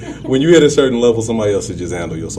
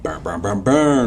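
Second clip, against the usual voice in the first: imitated horn blasts; slower than usual. Host hypes another episode, imitated horn blasts. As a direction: punch out horn noises, building to the last.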